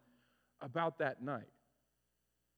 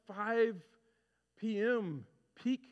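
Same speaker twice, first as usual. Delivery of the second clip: ordinary talk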